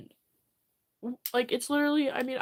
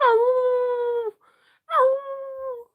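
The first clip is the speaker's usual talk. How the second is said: high, loud pained yelps; crying out; 9 semitones higher, 11 dB louder than usual. Real, not acted. Streamer on stream, yelping out loud. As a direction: yelp it out sharply.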